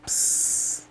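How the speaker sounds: whispered psst; right in your ear. Pretend. Whispering into the headphones.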